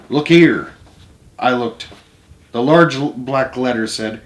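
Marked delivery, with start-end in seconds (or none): 0.1-0.7 a raised call, pointing at the page; louder, call his attention to it. A narrator's reading. Grandpa pointing at the newspaper for the boy, loud. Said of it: "he said in a loud voice".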